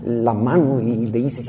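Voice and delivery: normal talking voice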